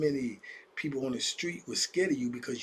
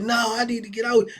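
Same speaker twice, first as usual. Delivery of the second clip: raised, higher panicked cry; mock yell; 7 semitones higher, 8 dB louder than usual. Youngin yelling at the old timer to let him leave, panicked shout. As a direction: yell to get out of the cell.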